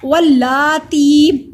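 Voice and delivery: वल्लाती very slow and loud with double l; measured recitation with long vowels; lengthened vowels. Shown as a demonstration. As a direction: stretch laa and tee in वल्लाती. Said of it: "ती को लंबा करेंगे".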